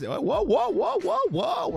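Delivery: high, wobbly run of whoas; playfully taken aback, pitch jumping up; exaggerated high-pitched protest; mock alarm. Reacts to an all-caps chat message.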